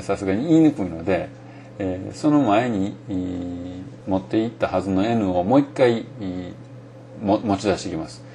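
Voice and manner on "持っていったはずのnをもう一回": normal talking voice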